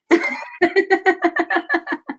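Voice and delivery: rising laugh. Real, not acted. Teacher laughing after voicing the ego. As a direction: laugh at your own ego voice.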